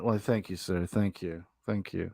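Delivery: a hint of a gracious bow; understated mock-humble thanks. Bowing to the chat's praise.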